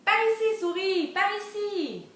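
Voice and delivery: high, loud call; calling the mouse. Acted. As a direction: beckon the mouse over. Calls the mouse in story.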